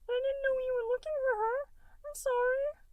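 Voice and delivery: a tiny blob kid, sweet and distracted; little gelatinous Goo. A narrator's reading. Little Goo putting off dinner, high and bright. Goo's voice.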